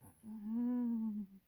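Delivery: a real, high, loud happy laugh; excited burst of laughter. Real, not acted. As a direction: let an overjoyed laugh burst out. Overwhelmed by her book launch.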